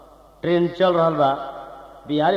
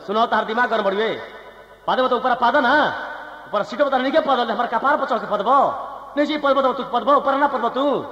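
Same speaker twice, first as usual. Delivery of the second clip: high, heated rant breaking into laughs; angry laughing rant; 6 semitones higher than usual. Acted. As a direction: scold angrily, half laughing. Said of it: "और गरम हो गए".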